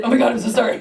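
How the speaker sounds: startled but polite apology; apologetic instead of screaming. Retold or imitated. Hiker apologising to a bear, startled and polite. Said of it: "I didn't scream, I said".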